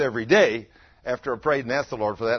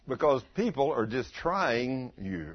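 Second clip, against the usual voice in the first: ordinary talk, nothing marked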